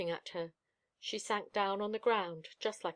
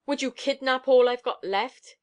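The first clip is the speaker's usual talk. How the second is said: loud, higher savage snarl; 4 semitones higher, 9 dB louder than usual. A narrator's reading. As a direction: hurl it back savagely. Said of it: "she said savagely over her shoulder".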